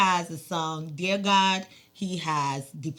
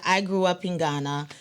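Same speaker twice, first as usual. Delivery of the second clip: ordinary talk, nothing marked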